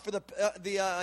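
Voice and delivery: ordinary talk, nothing marked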